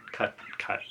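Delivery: breathy whispered cut, cut as a joke; whispered aside. Pretend. Mock editor cutting his rambling, whispered. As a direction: whisper it like a quick editing note. Joking about editing out rambling.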